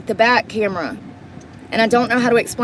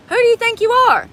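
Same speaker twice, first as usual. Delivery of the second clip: indignant yell; 12 semitones higher than usual. Real, not acted. To the driver hogging parking spaces, a high outraged demand. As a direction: fling the question at him, outraged. Ranting at a bad parker.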